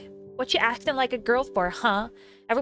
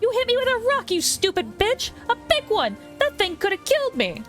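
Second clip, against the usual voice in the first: furious shriek; 14 semitones higher, 6 dB louder than usual. Acted. Bully shrieking in pain.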